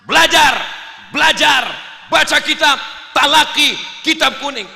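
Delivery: loud, high rallying shouts; rousing exhortation. Acted. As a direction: fire off each command like a coach. Bangkitkan semangatnya.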